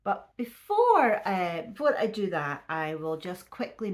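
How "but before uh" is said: ordinary talk, nothing marked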